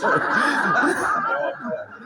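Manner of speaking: loud high burst of laughter; cracking up at his own story; big laugh. Real, not acted. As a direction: let a real laugh roll out. Big laugh after punchline.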